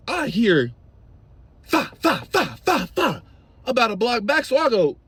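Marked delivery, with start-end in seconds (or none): 1.6-3.2 imitated gunfire. Imitating gunshots a block back.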